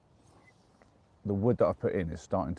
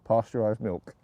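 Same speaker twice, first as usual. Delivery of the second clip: ordinary talk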